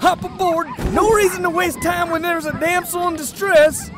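high, urgent frontier call; hurrying them along. Acted. Fake guide hustling the lawmen onto sleds, urgent. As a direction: pitch it up and rush the customers on.

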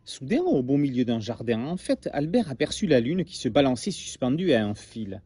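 lively storybook read-aloud; story narration. A narrator's reading. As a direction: tell it like a bedtime tale to children. Reads story to class.

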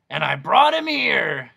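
loud exasperated doctor. Acted. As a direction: push on through annoyance.

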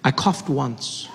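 ordinary talk

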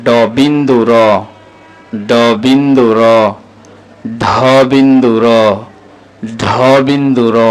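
slow, deliberate letter-by-letter recital; name each letter clearly, pausing after each; measured model reading for learners to repeat; alphabet recitation. Shown as a demonstration.

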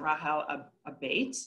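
ordinary delivery

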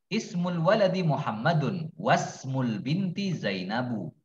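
slow model reading, "wasmul" joined without a glottal start; silent hamzah in linking. Shown as a demonstration. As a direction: read "Ismul waladi Muhammadun wasmul binti Zainabu" slowly as one linked chain. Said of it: "Jangan dibaca".

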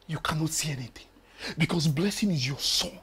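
harsh whisper. Acted. Husband to wife, whispered accusation. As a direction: say it low, under your breath.

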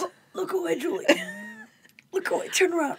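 high, laughing plea; mock squeamish Monk. Imitating Monk begging Julie to look away, high and laughing. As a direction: squeal like a fussy detective. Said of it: "he's like".

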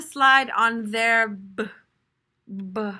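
slow sounding out. Shown as a demonstration. Teacher slowly sounding out a word for the class. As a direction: stretch each word slowly, stalling on the b. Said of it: "I don't know what this word is".

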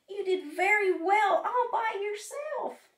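high, loud encouragement; cheerful praise. A narrator's reading. Frog cheerfully praises Toad.

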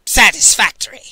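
loud, gruff grunt from a huge woman; grunted verdict. A narrator's reading. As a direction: bark it out like inspecting cattle. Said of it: "she grunted".

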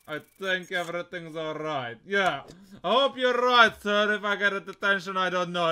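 put-on Russian accent. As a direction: play a border official with a Russian accent. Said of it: "where's my Russian accent?".

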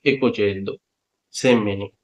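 ordinary delivery

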